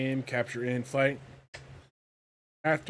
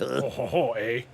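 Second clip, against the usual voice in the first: put-on French Canadian accent; slower than usual. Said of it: "feast on that French Canadian accent".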